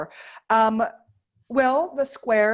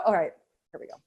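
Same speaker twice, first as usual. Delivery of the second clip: usual speaking voice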